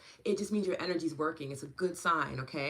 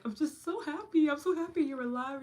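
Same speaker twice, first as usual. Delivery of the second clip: much higher and louder gushing; gushing joy; 10 semitones higher, 5 dB louder than usual. Retold or imitated. Host retelling how she gushes at her niece, much higher and louder. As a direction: overflow with happiness. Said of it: "I'm just like".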